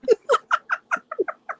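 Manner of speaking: shrieking laugh, high and loud; high burst of laughter. Real, not acted. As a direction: let out a high cackle. Laughing at her own story.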